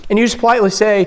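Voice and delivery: ordinary talk, nothing marked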